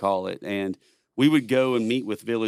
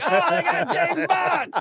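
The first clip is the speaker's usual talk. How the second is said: much higher, slow, hammed-up yelp with a laugh; mock panicked Taliban; 3 dB louder, slower than usual. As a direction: squeal it like a frightened enemy. Taliban imagined hearing them.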